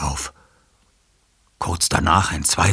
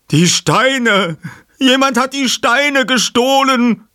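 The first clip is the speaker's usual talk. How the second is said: verzweifelter Aufschrei; 8 dB louder, slower than usual. Acted. Sanchez entdeckt den Diebstahl.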